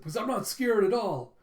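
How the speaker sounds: high, loud acted protest; hammed-up bravado; defiant denial. Boy insists to his friend he isn't afraid, defiant.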